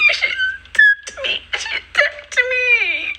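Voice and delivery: mock crying. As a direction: imitate her wailing, way up high and dragged out. Mocking friend's crying after push.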